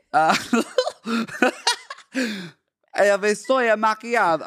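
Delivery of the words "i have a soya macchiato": put-on louder, botched Russian accent; Russian accent slipping toward Italian; bad Russian accent. Starbucks worker calling an order, badly faked Russian accent. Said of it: "I can't do Russian accent".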